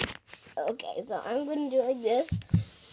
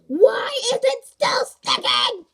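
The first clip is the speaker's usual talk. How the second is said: exasperated shout; 9 semitones higher, 11 dB louder, slower than usual. Real, not acted. Frustrated at sticky slime.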